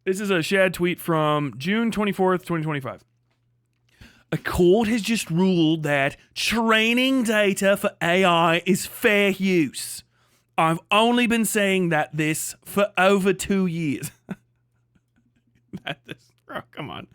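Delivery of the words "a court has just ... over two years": slow Australian read-aloud; hammed-up slow Australian; mock Australian accent. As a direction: read it in a drawn-out Aussie accent. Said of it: "I'm not doing that for the rest of the time".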